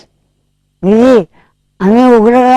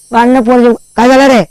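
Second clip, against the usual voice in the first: normal talking voice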